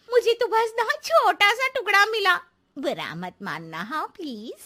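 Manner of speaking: ordinary talk, nothing marked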